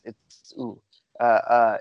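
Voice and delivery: ordinary talk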